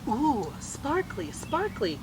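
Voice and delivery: put-on high, slow little-boy coo. A narrator's reading. Casey, the little brother, cooing at the bracelet. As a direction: draw it out in wonder like a toddler. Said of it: "Casey said".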